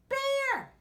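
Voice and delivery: high, loud alarmed shout. A narrator's reading. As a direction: yell to rouse a sleeping bear. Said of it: "cried Ladybug".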